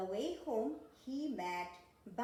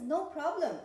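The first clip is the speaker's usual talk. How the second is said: loud, higher, cheerful yes; eager agreement; 5 semitones higher, 9 dB louder than usual. Acted. Owl agrees to help little monkey, eager and loud. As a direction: agree heartily, voice lifted.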